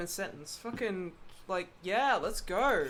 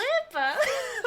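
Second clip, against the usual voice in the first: high burst of laughter; 8 dB louder than usual. Real, not acted. Laughs at own voice.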